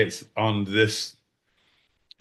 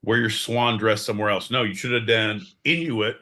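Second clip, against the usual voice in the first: ordinary delivery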